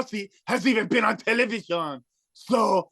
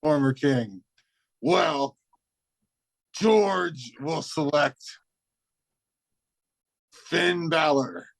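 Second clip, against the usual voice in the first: deep, slow menacing space villain; a low, looming alien threat; Spaceman George voice; 6 semitones lower, slower than usual. Acted. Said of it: "It is I, Spaceman George".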